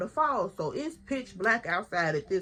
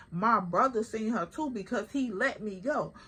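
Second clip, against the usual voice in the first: ordinary delivery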